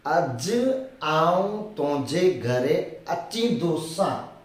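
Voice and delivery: dragged-out words, one at a time; very slow dictation. Shown as a demonstration. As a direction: say it slowly for a beginner. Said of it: "teacher repeats sentence".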